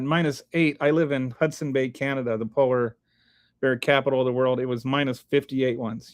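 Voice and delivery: ordinary delivery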